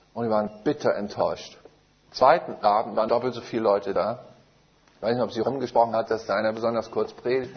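usual speaking voice

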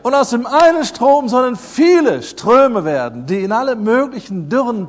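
loud, high, rousing proclamation; preacher building to a ringing charge; full-force preaching. Real, not acted. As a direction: call it out to the whole congregation.

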